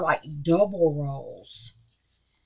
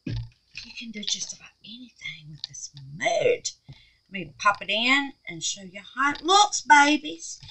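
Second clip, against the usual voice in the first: ordinary talk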